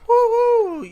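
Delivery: high, loud celebratory cheer; excited whoop. Real, not acted. Host cheering after the segment jingle, excited whoop. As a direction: let out a big high "woohoo".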